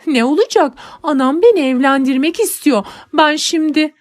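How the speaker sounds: put-on distraught girl confessing to nanny; tearful outburst. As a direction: blurt it out through tears. Girl cries out her trouble.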